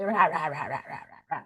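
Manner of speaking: soft voiceless rah rah, fading out; a whispered hippo roar as a greeting; breathy rah rah for the hippo card. Shown as a demonstration. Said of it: "I want the students to say".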